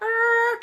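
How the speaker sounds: imitated high tyre screech; high-pitched mimicked skid noise; screeching car. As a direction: screech like a car swerving round. Imitates car turning around sharply.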